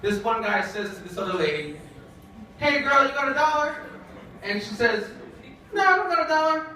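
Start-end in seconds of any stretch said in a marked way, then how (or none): 2.6-3.8 high-pitched street call-out; street hustler. Acted. Man on the corner asks a lady for money, high calling voice. 5.7-6.7 high-pitched street refusal; put-on woman's voice. Lady on the street turns the man down, high put-on voice.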